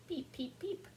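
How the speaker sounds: high little peeps; frog peeping. A narrator's reading. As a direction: peep like a small frog. Says the frog.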